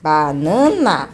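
"Banana" slowly, ba-naa-na in three beats; "Banana" drawn out for a learner; syllable by syllable. Shown as a demonstration. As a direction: count the syllables through "Banana". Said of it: "Três".

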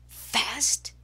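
ordinary talk

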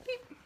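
tiny high-pitched beep; imitated beep. Coach plugging something in, high imitated beep. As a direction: make a short, high electronic beep.

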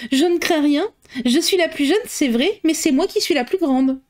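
decided tone. A narrator's reading. Lydia answering her mother about the ball, decided. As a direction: say it with confident resolve. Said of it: "d'un ton décidé".

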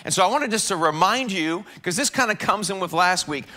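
ordinary talk

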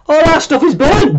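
high-pitched acted wail; upset child character. Mia to those who burnt her house, dismayed wail.

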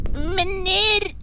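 usual speaking voice

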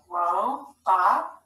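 "Robot" sounded out as two separate stretched syllables. Shown as a demonstration. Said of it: "Scoop it".

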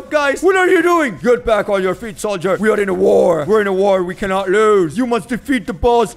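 loud drill sergeant shouting at fallen soldiers; rallying the troops. Acted. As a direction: bark orders like a commander at war. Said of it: "how to motivate them".

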